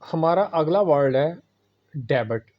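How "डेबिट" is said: डेबिट as de-bit, the b sounded; डेबिट in two syllables; wrong pronunciation. Shown as a demonstration. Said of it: "ये लोग गलत बोलते हैं".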